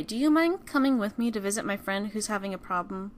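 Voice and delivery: ordinary delivery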